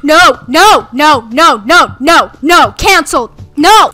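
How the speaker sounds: loud repeated frightened no; panicked screaming. Real, not acted. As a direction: scream no over and over. Monster blocks the way.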